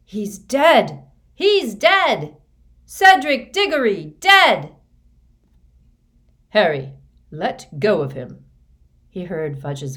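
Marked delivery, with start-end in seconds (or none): 0.0-4.7 high, very loud panicked cries; screeched shouting. A narrator's reading. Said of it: "others shouted it, screeched it, into the night". 6.5-8.4 loud, emphatic command; firm order. A narrator's reading. Fudge orders boy to release body.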